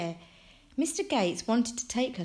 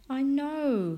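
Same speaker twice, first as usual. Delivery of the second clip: high, drawn-out sad resignation; 8 semitones higher, 3 dB louder than usual. A narrator's reading. Franklin accepting the move from his dad, sad. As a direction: give in sadly, pitched up. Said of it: "Franklin said sadly".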